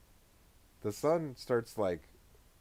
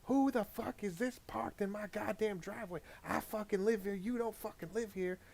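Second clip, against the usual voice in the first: mom's rant, pitched far higher, a bit softer; angry mom; 10 semitones higher, 4 dB quieter than usual. Retold or imitated. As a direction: play the furious mother, high and shrill. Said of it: "she's like".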